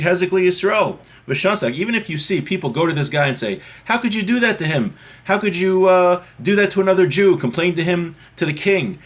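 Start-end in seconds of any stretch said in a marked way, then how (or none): 3.8-9.0 high-pitched, louder mock outrage; retold confrontation. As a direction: imitate people challenging someone on a friend's behalf. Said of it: "they start fighting with this guy".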